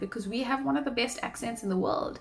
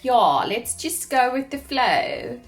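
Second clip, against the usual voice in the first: high, loud, drawn-out laid-back voice; 5 semitones higher, 6 dB louder, slower than usual. Acted. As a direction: exaggerate a laid-back friend, pitched up.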